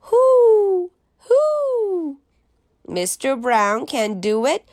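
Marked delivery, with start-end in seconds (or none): 0.0-2.2 very high owl hoo; owl hoot. Pretend. Teacher hooting for the class like an owl, very high. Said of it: "还有一位是猫头鹰，owl".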